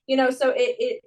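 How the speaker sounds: ordinary delivery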